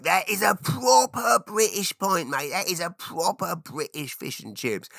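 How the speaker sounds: hammed-up British accent; mock British expat. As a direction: do an exaggerated Brit praising fish and chips. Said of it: "I'm like".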